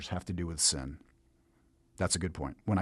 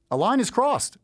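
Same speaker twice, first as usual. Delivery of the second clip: a high laugh into the words; 12 semitones higher, 4 dB louder than usual. Real, not acted. Preacher laughing while explaining a stolen donkey. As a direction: laugh as you say it.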